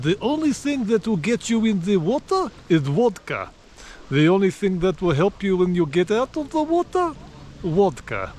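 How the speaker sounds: lightly put-on Russian accent, playing the local bloke. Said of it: "but I'll do Russian voice".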